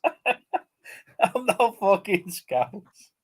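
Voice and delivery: laughing through it, real laugh; laughing denial. Real, not acted. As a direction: protest while cracking up. Denies being scouse, laughing.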